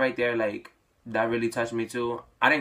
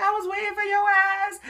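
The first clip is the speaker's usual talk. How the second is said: shrieking way up high and loud, mock-crazy; playful excited scream; 5 dB louder than usual. Sister shrieking with joy at her brother coming out. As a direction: scream it like you're thrilled, half joking. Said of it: "She went crazy. But just playing around".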